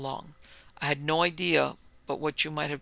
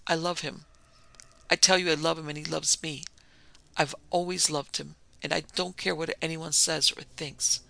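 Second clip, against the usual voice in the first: ordinary delivery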